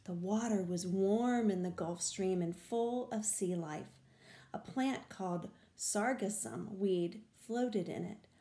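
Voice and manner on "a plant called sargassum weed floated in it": plain reading voice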